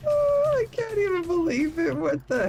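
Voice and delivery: squealing. Real, not acted. Squealing over child fan.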